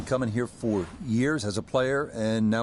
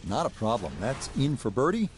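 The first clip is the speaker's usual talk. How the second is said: hushed, measured golf commentary; golf commentator; 5 dB quieter than usual. Acted. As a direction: softly call the shots like a TV broadcaster. Live golf play-by-play, hushed.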